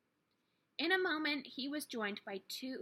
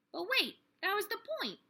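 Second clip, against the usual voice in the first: quick, high burst of insight; sudden realization; 11 semitones higher, 4 dB louder, much faster than usual. A narrator's reading. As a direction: snap into it, much higher and fast.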